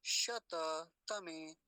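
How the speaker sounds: शततमे stretched syllable by syllable, very slow; drawn out demo. Shown as a demonstration. Teacher sounding out शततमे slowly for students. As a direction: spell out शततमे slowly, each syllable clear. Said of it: "ये देख रहे हैं ना".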